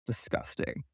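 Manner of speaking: whispered in disgust, like a sneer; retold whisper. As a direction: breathe it out with contempt. Retold insult, evil look.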